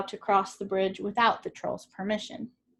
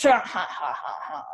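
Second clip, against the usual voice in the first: troll laugh; 7 semitones lower, 4 dB louder than usual. A narrator's reading.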